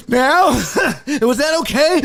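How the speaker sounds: high, loud, anxious questions; mock panicked asking. Patient anxiously asking the doctor if she did it right. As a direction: plead for approval in a frantic rush.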